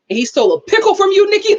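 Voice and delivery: hammed-up loud, high-pitched disbelief; upset stepdad. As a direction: imitate an outraged stepdad, rushing it. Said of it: "Like, he was so upset".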